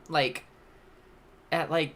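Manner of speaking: ordinary delivery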